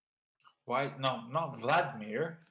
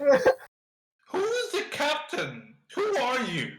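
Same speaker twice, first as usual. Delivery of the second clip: a furious captain confronting boarders; outraged captain; 12 semitones higher than usual. Acted. Captain demanding answers from the pirate crew, outraged.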